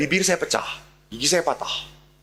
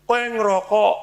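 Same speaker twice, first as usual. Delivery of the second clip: pitched higher, pressing harder; angrier father repeat; 8 semitones higher, 4 dB louder than usual. Retold or imitated. Father pressing his son about smoking, sharper and higher. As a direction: demand it again, fiercer.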